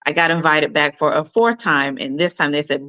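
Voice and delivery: usual speaking voice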